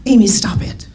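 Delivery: urgent whisper; whispered hush. Retold or imitated. Hushing her laughing sister in the hospital hallway, whispered. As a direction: hiss it under your breath to hush her.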